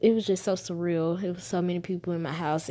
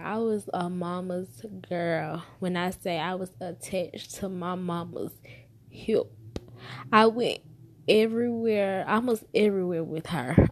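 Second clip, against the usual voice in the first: usual speaking voice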